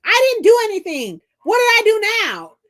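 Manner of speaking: loud, high-pitched mock protest; hammed-up, loud defensiveness; indignant protest. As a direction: yelp it like you're wrongly accused. Retells protesting to the manager.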